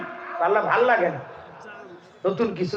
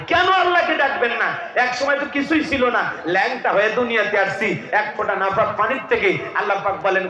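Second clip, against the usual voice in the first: ordinary talk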